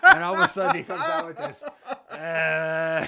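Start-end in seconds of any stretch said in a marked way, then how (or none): 2.1-3.1 loud sloppy mock drunken guffaw; drunk laugh. Mimicking his drunk friend at the comedy show, loud sloppy guffaw. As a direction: imitate a wasted friend's laugh. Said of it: "doing that drunk laugh".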